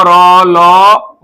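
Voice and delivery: "रो" and "लो" stretched out, each held long; very slow demo. Shown as a demonstration. Teacher drawing out "रो" and "लो" for the class. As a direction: drag each syllable out for the learners. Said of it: "ये कंठ जो है".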